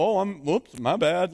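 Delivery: loud, bumbling sitcom-husband apology; doofus husband. Acted. Preacher mocking God as sitcom husband, doofus voice. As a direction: play a hapless doofus owning up. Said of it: "like, uh, the doofus husband".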